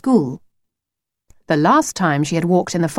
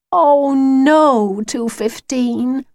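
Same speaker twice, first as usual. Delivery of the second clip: high, drawn-out groan of dismay; 6 semitones higher, much slower than usual. Acted. Lucy dreads taking the wolf along, long groan. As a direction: groan it out slowly in a girl's voice. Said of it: "groaned Lucy".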